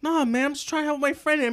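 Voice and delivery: high, innocent act; mock innocence. Student fake-innocently answering the teacher, high mock sincerity. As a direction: play it innocent. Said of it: "He's like".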